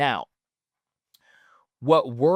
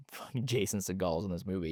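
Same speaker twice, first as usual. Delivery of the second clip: giddy whisper; 6 semitones lower, 9 dB quieter, faster than usual. Real, not acted. Excited aside about the cast.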